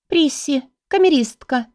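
high little squeak, eyes averted; squeaky. A narrator's reading. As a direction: squeak it out timidly. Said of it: "пискнула моя личная горничная".

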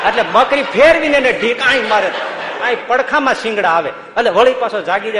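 can't hold back the laugh telling it; laughing storytelling. Real, not acted. Swami to the congregation, laughing through the goat story.

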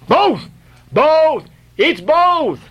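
high, joyful repeated shout. A narrator's reading. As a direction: shout the good news with joy. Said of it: "cried Daggoo again with a joyful shout".

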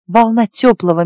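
plain reading voice

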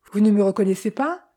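ordinary delivery

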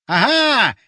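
high, loud cry of triumph; triumphant shout. A narrator's reading. Borya as the flames drive the creatures back, triumphant shout. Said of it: "торжествующе закричал Боря".

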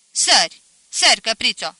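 sharp, loud playful command; loud urging. A narrator's reading. Groom to bride at the threshold, loudly urging. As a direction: call out for her to jump.

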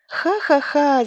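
high, loud read-out ha-ha-ha; storybook girl's mock laughter; peals of laughter. A narrator's reading. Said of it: "заливалась дочка".

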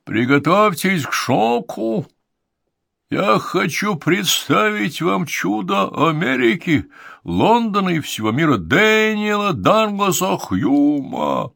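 ringmaster. Acted. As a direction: sell it like the circus star act. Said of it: "Голос конферансье достиг наивысшего накала".

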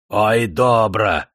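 slow, emphatic admiration; relishing praise. A narrator's reading. Paralytic admires the executioner's bloody work, relishing. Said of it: "хвалил он ката".

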